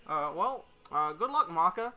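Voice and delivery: lightly voiced Kermit, hesitant send-off. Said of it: "I gotta do the Kermit voice".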